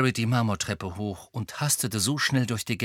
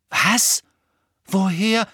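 high, loud, startled questions; shocked disbelief; 9 semitones higher, 7 dB louder, slower than usual. A narrator's reading. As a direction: blurt out two short questions in astonishment. Friends hear shocking news.

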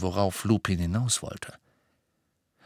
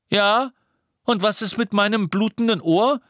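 laute Beschwerde; 12 semitones higher, 6 dB louder than usual. A narrator's reading. George beschwert sich laut.

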